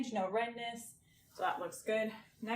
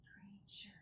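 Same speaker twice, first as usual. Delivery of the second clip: soft whispered test words; breathy whisper for a hearing test; 19 dB quieter than usual. Shown as a demonstration. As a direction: whisper it so only the patient can hear. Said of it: "So I'm gonna whisper something".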